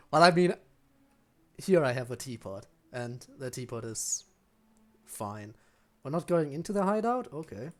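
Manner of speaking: ordinary talk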